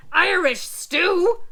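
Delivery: loud, slow, outraged holler; hollering pirate. Acted. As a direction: bellow it in disgust. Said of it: "he hollered".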